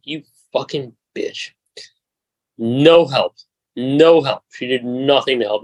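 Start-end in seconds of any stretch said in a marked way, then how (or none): 0.0-1.5 slow, soft muttered curse under the breath; muttered insult. Retold or imitated. Muttering at the rental clerk, soft and slow. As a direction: drag it out quietly, seething. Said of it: "And I was like".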